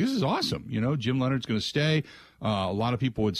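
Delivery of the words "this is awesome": mock delight. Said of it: "I'm like".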